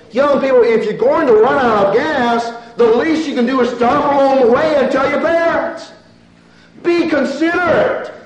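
loud, high pulpit exhortation; forceful, high-pitched sermon appeal; preach. Real, not acted.

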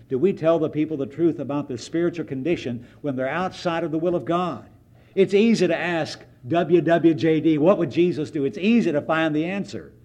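loud, driving pulpit preaching; ringing sermon climax; preaching at full force. Acted. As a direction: press the congregation hard.

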